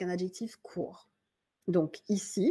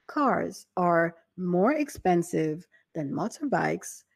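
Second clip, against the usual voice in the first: slow, careful example; slow model sentence; slower than usual. Shown as a demonstration. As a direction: read it slowly for learners. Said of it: "Donc, ça nous donne".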